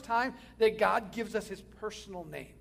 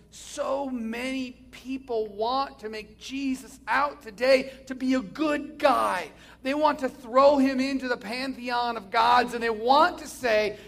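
louder, slow, pressing sermon voice; fervent preaching; 4 semitones higher, 7 dB louder, slower than usual. Real, not acted. Preacher warning the congregation about a watered-down Jesus, fervent preaching. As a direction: preach it hard, building.

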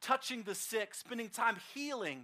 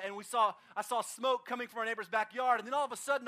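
ordinary talk, nothing marked

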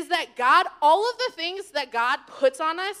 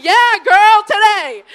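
much louder, punchy affirmation; loud emphatic; 4 semitones higher, 16 dB louder, much slower than usual. Retold or imitated. The speaker loudly telling the student yes. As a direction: belt it out with sass.

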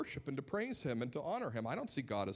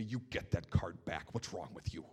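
lower, stern scolding dad voice; angry dad; 9 semitones lower than usual. Retold or imitated. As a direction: growl it down low like an angry father. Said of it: "with pure anger".

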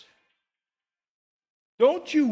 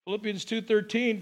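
ordinary talk

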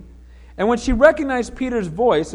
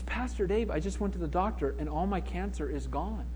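very soft, subdued retelling of tearful news; hushed, mildly tearful phone voice; imitated tearful caller; 3 semitones lower, 10 dB quieter than usual. Woman phoning her pastor about being healed, softly tearful. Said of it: "she's crying on the phone".

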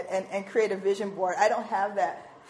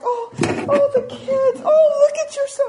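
very high, loud cooing delight; hammed-up fawning over children; gushing seniors; 10 dB louder, slower than usual. Seniors on a cruise gushing over kids, delighted. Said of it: "those seniors were like".

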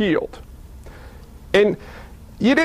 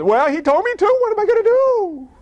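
cowardly excuse; 10 semitones higher than usual. Acted. Healed man answering the Sabbath accusers, high helpless excuse. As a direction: protest climbing to a very high pitch.

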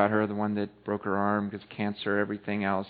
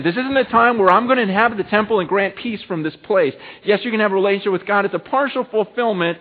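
high, loud proclamation; urgent sermon delivery in Jesus's words; raised preaching voice; 9 semitones higher, 8 dB louder than usual. Acted. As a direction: lift the voice and declare it to the crowd.

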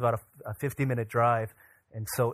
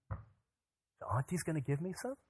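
small, soft, higher voice asking; hopeful little child; 4 semitones higher, 10 dB quieter than usual. Retold or imitated. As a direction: imitate a child daring to hope, rising question. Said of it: "Elise stopped crying, and she said".

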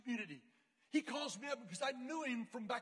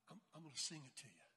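soft, low stammered announcement; hushed hesitant admission; 9 semitones lower, 13 dB quieter, faster than usual. Retold or imitated. Girl telling her class she will sing, hushed. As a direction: admit it quietly, in a low voice.

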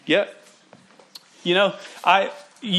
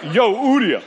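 name split into a loud, high "Yo, Udia" in a joking accent; 5 semitones higher, 4 dB louder than usual. Shown as a demonstration. Preacher playing with a weird name for the church, joking accent. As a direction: call it out like a street greeting, accent played up. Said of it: "in that accent".

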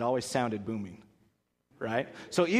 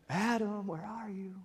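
soft, higher calling voice of God; softly calling for Adam, higher; 6 semitones higher, 8 dB quieter, slower than usual. Acted. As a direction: call gently for Adam.

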